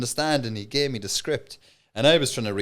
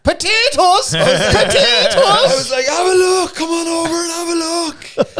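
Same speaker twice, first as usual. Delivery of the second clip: high, loud stallholder's yell; market shouting; 10 dB louder, slower than usual. Retold or imitated. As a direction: bellow it across the market to pull a crowd. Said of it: "so I just started shouting".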